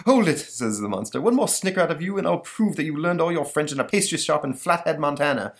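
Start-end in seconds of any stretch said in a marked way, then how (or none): 0.1-0.4 high, loud interruption; mock-stern monster outburst; annoyed monster. Monster cuts off Napoleon's laughing. 1.2-5.5 higher, put-on monster voice; offended cartoon beast; threatening monster.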